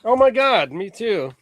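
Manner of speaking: high, startled outburst that eases off; startled exclamation. Real, not acted. Co-host reacting to the album, astonished. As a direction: burst out high in real surprise, then settle. Exclaims over what is shown.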